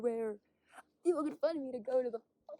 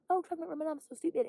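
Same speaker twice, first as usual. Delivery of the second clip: mock dismissive person; 5 semitones higher, 6 dB louder, faster than usual. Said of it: "have people say".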